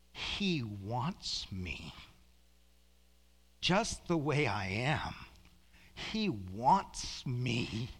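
very slow, drawn-out amazement; awed wonder. Retold or imitated. Preacher marvelling at God's acceptance, slow awed delivery. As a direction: stretch each word in wonder.

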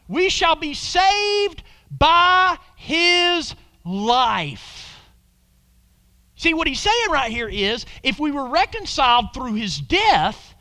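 high, loud, fervent preaching; full-force preaching. Acted. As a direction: preach it out to the whole room.

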